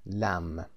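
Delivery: "لم" closing on M without any B; silent B. Shown as a demonstration. Teacher naming sheep meat for students, silent B in "لم". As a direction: leave the B off the end of "لم". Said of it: "بی تلفظ نمیشه".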